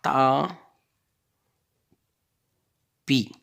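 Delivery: TA held long, long pause, then PI; syllable by syllable. Shown as a demonstration. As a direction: stretch "ta", wait, then drop in "pi". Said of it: "on va frapper les syllabes".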